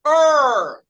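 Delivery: "er" as one long R-coloured vowel; R-controlled vowel. Shown as a demonstration. Teacher demonstrating the sound to students, long R-coloured "er". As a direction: hold "er" with the R swallowing the vowel. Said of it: "vogal que tá sendo controlada por uma consoante".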